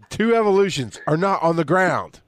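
high-pitched, emphatic comic protest; mock indignation. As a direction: argue the point in hammed-up outrage. Mock argument with co-host over ground type.

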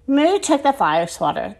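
usual speaking voice

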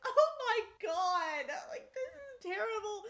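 high, slow, tearful wail; mock-sob it out as if the book broke you; crying reaction. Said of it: "I was like".

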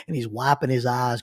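ordinary talk, nothing marked

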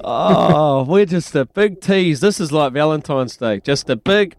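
loud, high, drawn-out lament; big, stretched-out comic complaint; mock exasperation. Real, not acted. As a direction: playfully bemoan the letdown at full volume. Joking lament over the lost pick.

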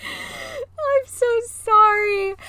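high, slow, drawn-out sorry through laughter; laughing apology. Real, not acted. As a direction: stretch "I'm so sorry" out high while giggling. Laughing apology to scared friend.

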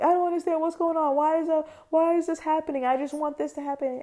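high, fast, anxious freak-out; frantic whiny complaining; panicked self-quote. Retold or imitated. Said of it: "and I'm like".

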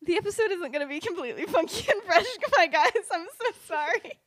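high-pitched, giggly confession; laughing apology. Real, not acted. Host confessing to listeners about the episode, laughing apologetically.

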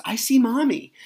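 put-on a kid piping up with a sighting; excited child. Son in the car tells dad he spotted Mommy, excited. Son spots mom at gas station.

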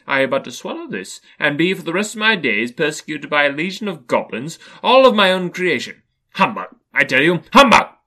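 loud, rising tirade ending in a high shout; defiant Scrooge. A narrator's reading. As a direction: build to an explosive dismissal of the ghost. Scrooge defies the ghost.

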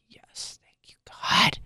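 real relief in an unvoiced, tearful whisper. Real, not acted. Mother whispers thanks once her son hugs her again, tearful. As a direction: whisper your thanks, near tears. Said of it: "that was like lifeblood".

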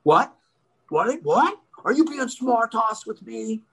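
high, challenging cop impression; offended cop. Cop answering a smart-ass driver, high mock indignation. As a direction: imitate a policeman taking offence. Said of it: "And he's like".

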